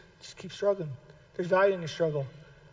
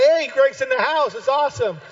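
cheery greeting; 6 semitones higher, 5 dB louder than usual. Retold or imitated. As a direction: greet the boss with mock cheer. Staff greeting him at the office.

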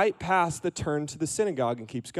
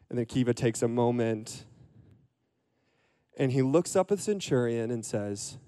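ordinary delivery

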